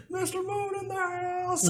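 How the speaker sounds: very high put-on voice; hammed-up announcement. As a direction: boast like a showman making an entrance. Mister Moon announcing himself.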